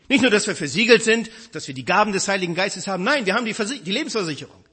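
preacher rousing the congregation, loud and fervent; full-force preaching. Acted. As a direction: drive the assurance home to the crowd.

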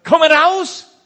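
very loud, high commanding shout; loud call. A narrator's reading. Jesus calling Lazarus out of the grave, ringing shout. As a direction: call the dead man out of the tomb. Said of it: "rief er mit lauter Stimme".

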